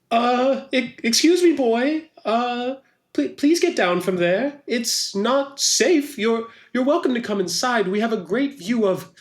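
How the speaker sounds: high-pitched, halting, polite plea; nervous onlooker. Acted. Worried bystander asks boy to climb down, nervous. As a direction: plead haltingly in a high character voice.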